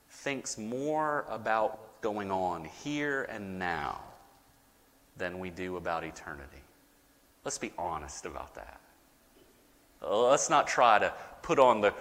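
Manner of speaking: ordinary talk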